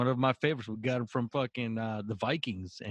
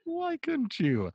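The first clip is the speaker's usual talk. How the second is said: much higher, slow echo; mock disbelief; slower than usual. Echoing his friend's jab at Romo, high and slow. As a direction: stretch it out high and mocking.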